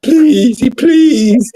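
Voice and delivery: high, drawn-out imitated begging; mock boohoo crying. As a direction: imitate a crying friend pleading desperately. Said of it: "He boo-hoo crying".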